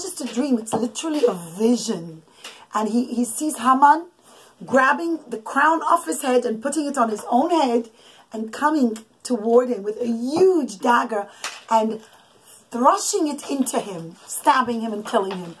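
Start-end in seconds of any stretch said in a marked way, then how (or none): none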